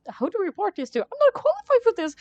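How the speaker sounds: high, loud flustered rambling; nervous guard panicking over a body; panicked security guard. Acted. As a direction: babble in a panic. Voices panicked game character.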